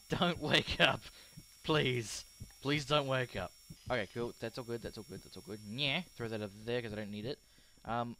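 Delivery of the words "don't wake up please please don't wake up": loud desperate begging; pleading. Real, not acted. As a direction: plead with the sleeping patient. Begging patient not to wake.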